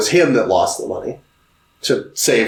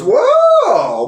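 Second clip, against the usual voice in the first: high, drawn-out mocking whoa; mock gloating; 12 semitones higher than usual. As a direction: crow it high, hammed-up. Retold uncle gloating at Potter.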